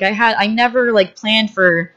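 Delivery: normal talking voice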